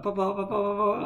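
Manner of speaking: put-on little girl summoning her dad; toddler calling. Daughter calling Papa after a little accident.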